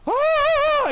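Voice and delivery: sudden high shriek of panic; panicked shriek. Retold or imitated. Mocking panicked critics with a high shriek. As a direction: shriek like a flustered puppet. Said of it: "like Beaker".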